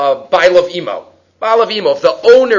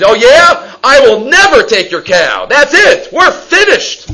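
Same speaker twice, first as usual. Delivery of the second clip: higher and louder, storming off in a huff; indignant mock outburst, higher and louder; angry retort; 7 semitones higher, 5 dB louder, slower than usual. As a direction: snap back like an offended neighbor. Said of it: "he was angry".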